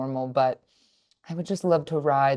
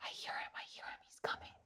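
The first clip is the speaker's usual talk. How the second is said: whisper; 25 dB quieter, much faster than usual. Real, not acted. Hiding from toddler, whispering.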